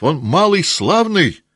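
high, loud cheer; hearty praise. Acted. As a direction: boast about your friend.

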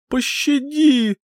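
very high, slow wailing plea for mercy; sobbing plea. A narrator's reading. Drowning youth sobs to the old singer for mercy, high wail. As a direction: cry out for mercy through tears. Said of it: "рыдал Йоу Кахайнин".

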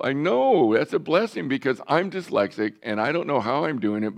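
loud, high burst of enthusiasm; excited exclamation. Retold or imitated. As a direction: re-enact an emphatic, delighted revelation. Acted excited answer to the man.

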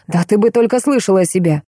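usual speaking voice

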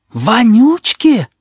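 slow, high horrified cry. A narrator's reading. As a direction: recoil in horror, drawn out. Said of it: "в один голос ужаснулись".

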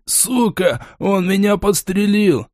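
high, slow yelling in pain; man down shout. Acted. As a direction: cry out wounded, pitched high. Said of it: "заорал раненый". (крик раненого)

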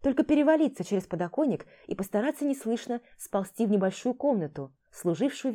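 normal talking voice

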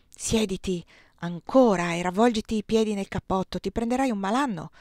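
plain reading voice

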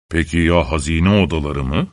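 low grumble rising into a question; grumbling. A narrator's reading. Husband asking about his treasure rooms, low suspicious grumble. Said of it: "diye homurdanmış".

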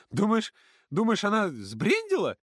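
put-on high, loud girl's disbelief; hiccuping with laughter. A narrator's reading. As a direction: hiccup through leftover laughter. Ksyusha girl voice. (Ксюша икает от смеха)

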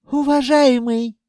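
high, loud djinn address; wheedling djinn. A narrator's reading. Djinn tugs the owner's sleeve, wheedling. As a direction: call for attention in a high voice.

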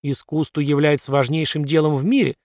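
plain reading voice

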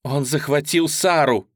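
loud, urgent blurt. Acted. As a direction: blurt it out in panic. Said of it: "выпалила она".

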